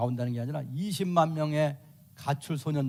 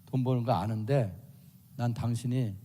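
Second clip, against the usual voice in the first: lower, softer calm voice; a wife calmly raising a complaint, lower and softer; 6 semitones lower, 5 dB quieter than usual. Acted. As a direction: ask your husband gently, without heat. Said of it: "이렇게 말로".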